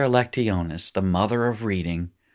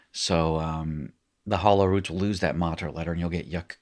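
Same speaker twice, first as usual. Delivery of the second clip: ordinary delivery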